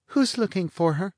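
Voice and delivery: high, languid teasing woman; bored blonde. A narrator's reading. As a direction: toss it off, lazily mocking.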